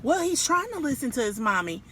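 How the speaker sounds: ordinary delivery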